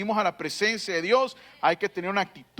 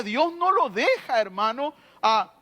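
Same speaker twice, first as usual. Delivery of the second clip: usual speaking voice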